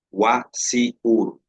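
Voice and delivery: "Wa si ur" broken into slow syllables; slow teacher sound-out; slow sounded-out English. Shown as a demonstration. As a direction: spell out "Wa si ur" slowly, sound by sound. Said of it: "dice así".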